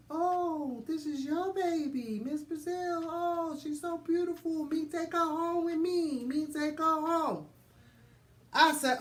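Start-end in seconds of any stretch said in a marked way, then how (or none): 0.1-7.5 higher, slow imitated Chinese accent. Nurse praising the baby, imitated Chinese accent. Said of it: "And she's like".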